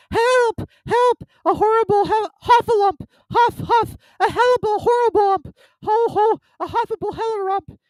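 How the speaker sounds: frantic high squealing, words tumbling into muddle; Piglet. A narrator's reading. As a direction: cry out in panic while scampering off. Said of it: "still crying out".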